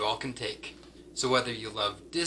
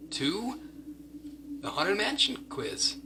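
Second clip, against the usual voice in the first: spooky high voice; 10 semitones higher, 4 dB quieter than usual. Pretend. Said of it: "Sorry if I scared you".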